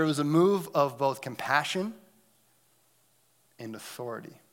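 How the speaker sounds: ordinary talk, nothing marked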